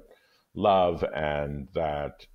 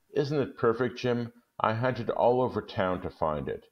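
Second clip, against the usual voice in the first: excited; 7 semitones higher than usual. Acted. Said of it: "Della shows her gift".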